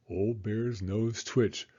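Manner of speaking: ordinary delivery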